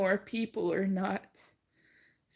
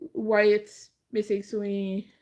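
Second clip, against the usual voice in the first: ordinary talk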